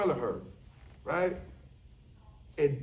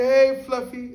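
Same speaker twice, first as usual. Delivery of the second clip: loud sing-song call to the cat; 8 semitones higher, 9 dB louder, slower than usual. Retold or imitated. Inmate calling his cat, loud and high. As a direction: call the cat from across the yard.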